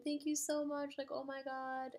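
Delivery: high-pitched retold gushing; mildly hammed-up thrilled winner; excited thanks. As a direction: replay being overwhelmed with gratitude.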